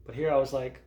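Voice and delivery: ordinary talk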